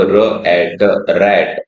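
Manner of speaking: र एट रैट blended slowly sound by sound; slow blending. Shown as a demonstration. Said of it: "तो देखो". (धीरे-धीरे जोड़कर पढ़ना)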